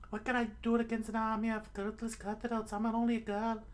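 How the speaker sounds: high put-on damsel voice; helpless girl act. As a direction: plead helplessness in a thin girly squeak. Excuse repeated as the girl.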